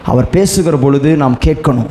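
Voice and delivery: usual speaking voice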